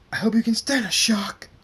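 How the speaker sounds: high, loud attack cry; shouted threat. Acted. Zapper about to strike Draconix with lightning, shouted threat. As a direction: yell it as a threat.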